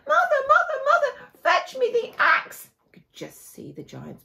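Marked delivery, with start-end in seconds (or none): 0.1-2.7 high, loud, desperate yell for the axe; urgent call. Acted. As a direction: shout for help while fleeing. Jack runs from the giant.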